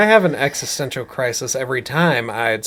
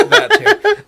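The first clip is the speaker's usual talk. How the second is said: laughing; 5 dB louder than usual. Real, not acted. Laughing reply.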